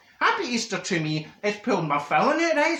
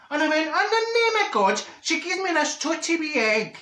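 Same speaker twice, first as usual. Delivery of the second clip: outraged exclamation; 10 semitones higher than usual. Acted. Marjorie reacting to the egg recall, high outraged cry. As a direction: cry out to the heavens, slowed.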